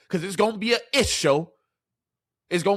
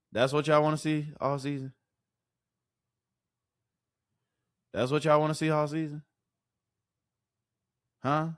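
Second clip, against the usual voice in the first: quiet disbelief; 2 semitones lower, 7 dB quieter than usual. Real, not acted. Repeating question at frustrated fans.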